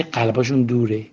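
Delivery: ordinary delivery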